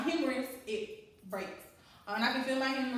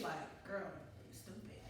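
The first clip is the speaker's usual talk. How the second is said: under-breath self-mockery; a quick whispered jab at yourself; whispered aside; 7 semitones lower, 15 dB quieter than usual. Real, not acted. Whispered aside about herself.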